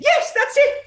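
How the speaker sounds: high, loud delighted cry; excited kids. Retold or imitated. Kids cheering that they finally understood, excited squeal. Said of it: "And they said".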